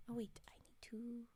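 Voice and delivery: soft ASMR whisper; breathy close-mic whisper. Real, not acted.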